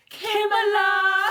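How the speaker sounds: high, drawn-out attempt at a British accent; botched British accent. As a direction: sing out the name in an overdone, badly faked British accent. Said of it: "I was still developing my accent".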